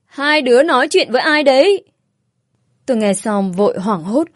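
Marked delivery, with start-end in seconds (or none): 0.0-1.8 higher, louder suspicious call from inside; woman inside calling to the children, louder. Acted. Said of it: "Tiếng người phụ nữ lại cất lên".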